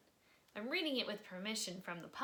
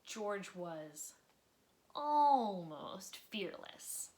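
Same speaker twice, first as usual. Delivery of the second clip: expressive read-aloud storytelling; lively children's book narrator; story narration; slower than usual. A narrator's reading. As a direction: read it like bedtime story time for kids. Reading a picture book aloud.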